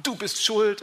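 children accusing their parents, mock reproach; mock accusation. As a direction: jab the blame at the parent. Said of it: "Und sagen".

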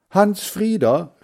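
high, loud call through the house. A narrator's reading. Mother calls her son to the doorbell, calling out. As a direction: call the boy from another room. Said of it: "rief Mutter".

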